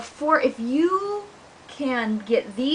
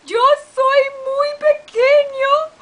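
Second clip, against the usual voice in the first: much higher, loud, slow fake crying; sobbing kitten; 7 dB louder, slower than usual. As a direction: wail like a sad kitten. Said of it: "we would sound like this".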